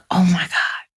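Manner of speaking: whispered oh my God; whispered realization. Retold or imitated. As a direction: hush it like a revelation. Quoting her own realization.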